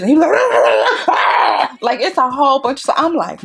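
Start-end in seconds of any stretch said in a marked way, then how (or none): 0.3-1.8 loud, high wail broken up by patting; muffled baby cry. Retold or imitated. Parent pats baby with pacifier in, stuttering wail. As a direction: imitate a crying baby being patted. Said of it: "you patting like this".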